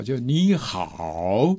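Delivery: 你好 said very slowly, the third tone dipping low; 你好 drawn out with a falling-dipping tone; third tone demo. Shown as a demonstration. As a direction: stretch 你好 slowly and let the tone sink down. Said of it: "一下子就降到下面来了".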